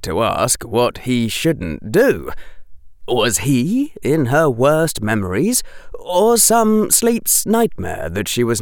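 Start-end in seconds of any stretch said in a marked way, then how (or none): none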